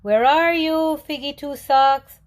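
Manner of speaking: high, loud call across the river; a loud, high, anxious shout at the river's edge. A narrator's reading. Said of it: "called again".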